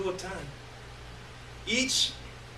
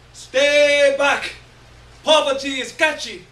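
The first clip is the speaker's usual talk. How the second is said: loud, higher jeering shout; higher screaming warning; 8 semitones higher, 10 dB louder, slower than usual. Acted. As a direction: yell it higher, sneering at the poor. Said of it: "It screams".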